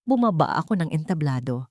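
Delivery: ordinary delivery, nothing marked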